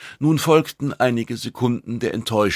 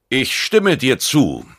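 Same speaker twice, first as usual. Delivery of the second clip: raised, higher voice, slow and deliberate; loud agreement; 6 semitones higher, 6 dB louder, slower than usual. A narrator's reading. Huge Ertruser agreeing so all present can hear, loud. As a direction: say it loud for the whole room. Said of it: "sagte er laut".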